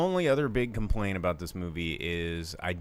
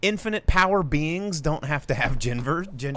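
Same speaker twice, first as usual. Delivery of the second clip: excited rant; 11 semitones higher, 5 dB louder than usual. Real, not acted. Excited rant about alien genders.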